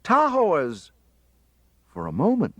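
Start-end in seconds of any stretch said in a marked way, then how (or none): 0.0-0.9 calling out. A narrator's reading. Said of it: "I called".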